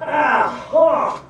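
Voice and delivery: pained yelp with groans; real cramp, crying out; leg cramp cry. Real, not acted. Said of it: "You get like a Charlie?".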